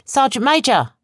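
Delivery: high urgent shout; urgent cry to stop the sergeant; cried out. A narrator's reading. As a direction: call his name sharply to stop him. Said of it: "Betsy cried out".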